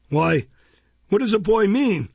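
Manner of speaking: loud, high, baffled outburst; astonished exclamation. A narrator's reading. Beadle astonished at the child's request, exclaiming. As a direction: exclaim it in pompous bewilderment. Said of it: "exclaimed Mister Bumble".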